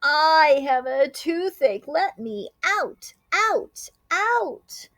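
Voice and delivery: high, loud pained cry; toothache shout. A narrator's reading. Hungry groundhog with a toothache shouting. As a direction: holler through a toothache. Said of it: "announced with a shout".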